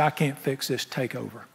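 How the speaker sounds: prayer said softly under the breath; soft surrendering prayer. Pretend. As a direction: hand it over to God, softly.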